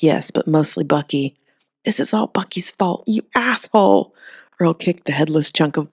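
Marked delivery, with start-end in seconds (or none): 1.8-4.1 rising into a yell at the end; angry outburst. A narrator's reading. Earl cursing his dead cousin in anger. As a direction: spit the blame out at the dead man. Earl blaming Bucky, kicking body.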